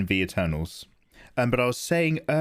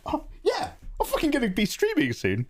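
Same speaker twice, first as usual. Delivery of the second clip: high, breathy excited boast; hyped; 13 semitones higher than usual. Retold or imitated. Streamer replaying his hype after Mel's stream, high. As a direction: squeal it with hype. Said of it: "I was so like".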